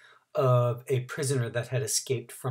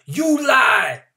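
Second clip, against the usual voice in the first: angry shout; 11 semitones higher, 10 dB louder than usual. A narrator's reading. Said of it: "somebody else called out".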